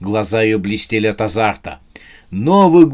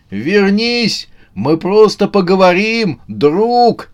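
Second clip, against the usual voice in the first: high-pitched coaxing shout; calling out; 8 semitones higher, slower than usual. A narrator's reading. Братец Январь calls the fugitive back, shouting. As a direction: call after someone running off. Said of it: "крикнул братец Январь".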